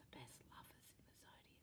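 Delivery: whispered fast, like a secret; hushed confiding whisper. Shown as a demonstration. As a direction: lean in and whisper it. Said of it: "I'm gonna tell you a secret".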